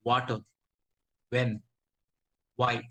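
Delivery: Water, When, Why each with lips rounded for the w; a teacher showing the w sound in Water, When, Why; lip-rounded w demo. Shown as a demonstration. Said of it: "Like this".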